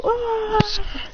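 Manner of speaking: high "ah" yell; knocked-back cry. Retold or imitated. Figures knocked back by shockwave.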